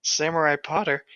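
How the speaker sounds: ordinary talk, nothing marked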